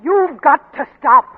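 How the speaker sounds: loud, pleading demand; wife insisting, loud and desperate; urgent plea. Acted. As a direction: beg him hard to stop. Wife pleading him to stop.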